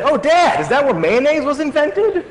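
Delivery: high, loud whiny-kid imitation; mock child's question, soaring at the end; kids' voice. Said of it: "My kids said".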